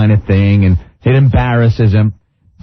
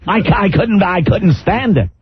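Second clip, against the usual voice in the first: Regis impression; 6 semitones higher than usual. Mocking Regis on air.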